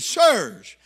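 loud preacher's imitation of a call; jailer's cry. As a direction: fire it out loudly.

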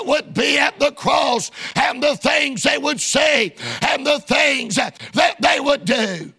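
driving, rhythmic pulpit cadence; preaching. Real, not acted. Preacher to congregation about the crowd at the cross, preaching hard. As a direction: preach it in forceful bursts.

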